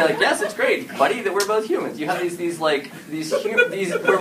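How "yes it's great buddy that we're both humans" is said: high, forced cheery yes with a laugh; ironic agreement. Retold or imitated. Secret alien humoring her friend, forced cheer. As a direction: fake it to your buddy. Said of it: "dramatic irony".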